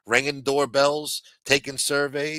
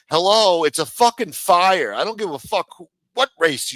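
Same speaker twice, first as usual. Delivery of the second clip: loud, higher, exasperated hello; exasperated outburst; 6 semitones higher, 6 dB louder than usual. Real, not acted. Ranting vlogger scoffs at fire hiring, loud exasperated outburst. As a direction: bellow it like it's obvious, slowing down.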